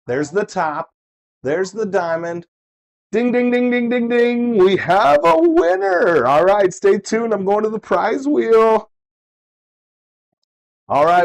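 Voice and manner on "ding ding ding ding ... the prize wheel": high, loud game-show ding ding; excited winner announcement. Real, not acted. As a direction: ring it out like a game show host.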